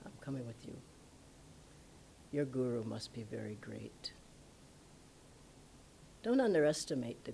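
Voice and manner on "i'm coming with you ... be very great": very low, soft, near-whispered; hushed awe. Retold or imitated. Humbled astrologer decides to follow the guru, hushed and low. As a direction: sink to an awed murmur.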